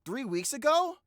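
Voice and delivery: high, loud burst of real surprise; astonished disbelief. Real, not acted. Startled by changed voice in clip.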